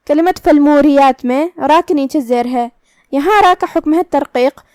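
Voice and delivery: ordinary talk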